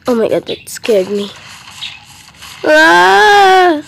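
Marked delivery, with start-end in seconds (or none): 2.6-3.8 high, loud startled cry; shocked yell. Real, not acted. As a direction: yelp in alarm. Second T-Rex appears.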